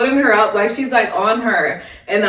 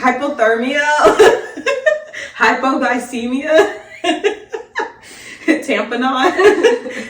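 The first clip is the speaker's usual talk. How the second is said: drawn-out terms broken by giggles; higher-pitched, laughing and stretching each term; laughing recitation; 5 semitones higher, slower than usual. Real, not acted. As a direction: list them slowly, cracking up between each. Joking list of H and T's.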